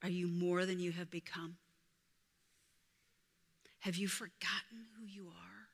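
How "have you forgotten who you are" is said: voiceless, much softer question; hushed question to the listener; whisper. Real, not acted. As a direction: lean in and ask gently. Preacher asks congregation softly.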